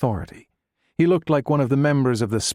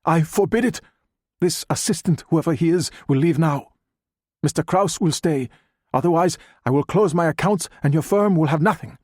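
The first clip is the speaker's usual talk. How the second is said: raised, higher, commanding protest; indignant scientist; 6 semitones higher than usual. A narrator's reading. Doctor Ma orders the assistant out, indignant and raised. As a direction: lay down the law to the banker. Ma's furious ultimatum.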